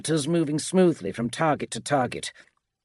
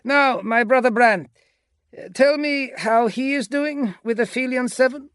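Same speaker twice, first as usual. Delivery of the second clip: high, raised commanding voice; commander Agapito, pleased; 13 semitones higher, 5 dB louder than usual. A narrator's reading. Agapito asks his lieutenant about his brother, pleased commander. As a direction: ask for news over the radio.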